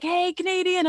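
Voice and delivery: high, loud hawker's call; pushy carpet seller. Retold or imitated. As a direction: call out like a street seller after a tourist. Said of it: "They're like".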